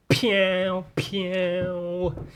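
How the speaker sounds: high drawn-out "pew pew"; playful "pew" sound effects, pitched high; mock shooting noises. Imitates web-shooting noises.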